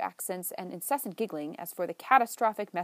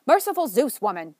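loud, high exasperated exclamation; exclaiming; 4 semitones higher, 10 dB louder than usual. Acted. David exclaims at Maggie over the phone, loud exasperation. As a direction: throw up your hands in exasperation. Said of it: "David exclaimed".

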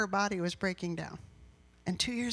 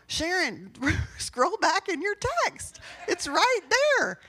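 louder, higher, slow laughing reply; laughing exasperation; 9 semitones higher, 6 dB louder, slower than usual. Retold or imitated. To Sharon about rereading her texts, laughing and louder.

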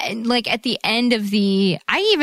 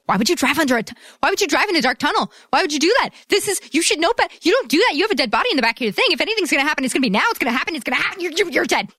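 high, fast frantic pleading; exasperated yelling at screen; 6 semitones higher, much faster than usual. Retold or imitated. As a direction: shout at the dumb character. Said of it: "I was just like".

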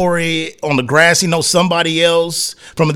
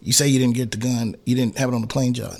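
low, soft, confidential commissioner; hushed mock commissioner; 6 semitones lower, 7 dB quieter than usual. Commissioner quietly letting a player off the hook, hushed. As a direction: murmur it like a back-room deal. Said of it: "let you like".

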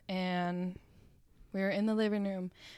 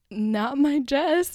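slow, raised, pleading imitation of a father; tearful dad; 7 semitones higher, 7 dB louder, much slower than usual. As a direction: draw it out loud and high in mock heartbreak. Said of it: "he starts crying right then".

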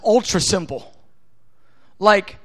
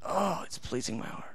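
much softer, lower, tender God voice; hushed delight, lightly voiced; voicing God; 6 semitones lower, 11 dB quieter than usual. Preacher voicing God pleased with Billy, soft and tender. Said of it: "He goes".

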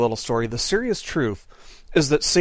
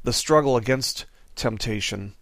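prayer. Real, not acted. Closing prayer before the sermon.

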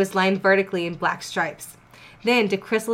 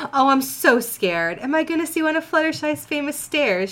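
sneering; 7 semitones higher than usual. Acted. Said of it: "she sneered".

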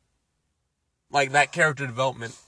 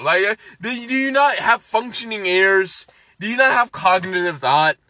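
loud, high exasperated yelling; furious rant; 10 semitones higher, 7 dB louder than usual. Real, not acted. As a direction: blow up at her in disbelief. Ranting at the character.

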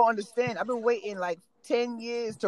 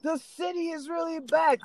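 excited retold shout; 8 semitones higher, slower than usual. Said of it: "I said".

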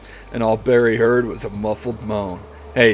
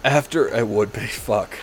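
ordinary delivery

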